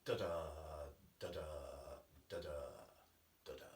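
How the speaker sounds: short sung da-da, clipped and repeated; snapped rhythm. Shown as a demonstration. Organist humming the cross rhythm for listeners, short and snapped. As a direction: tap out the snapped rhythm with your voice. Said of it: "an oppressive snapped rhythm".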